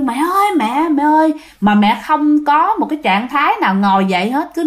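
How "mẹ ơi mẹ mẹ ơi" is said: higher, frightened call for mom; scared child calling. Retold or imitated. Frightened child calls mom who won't wake. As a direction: cry out for mother in the dark, afraid. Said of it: "bạn kêu".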